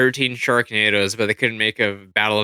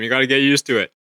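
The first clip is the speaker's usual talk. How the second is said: ordinary delivery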